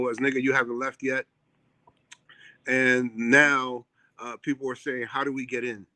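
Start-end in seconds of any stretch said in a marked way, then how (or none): none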